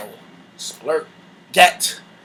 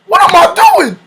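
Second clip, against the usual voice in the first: high, loud, alarmed question; mock panic; 9 semitones higher, 4 dB louder than usual. Questioning himself as his back hurts, mock panic. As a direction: blurt it high in disbelief.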